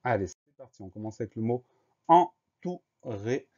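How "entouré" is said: "entouré" slowly split into en, tou, ré; louder, very slow, spaced-out "entouré"; syllable by syllable. Shown as a demonstration. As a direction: dictate "entouré" one separated syllable at a time. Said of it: "la première syllabe c'est en".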